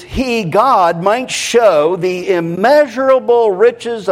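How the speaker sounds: high, emphatic preacher's reading in measured phrases; rhythmic scripture proclamation. Real, not acted. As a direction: proclaim each phrase, pitched well up.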